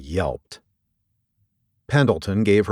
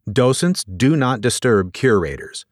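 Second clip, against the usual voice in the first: ordinary delivery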